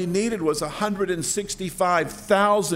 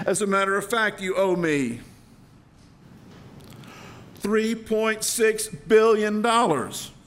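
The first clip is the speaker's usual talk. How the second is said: a king's stern, raised voice; 6 semitones higher than usual. Acted. King names the huge debt.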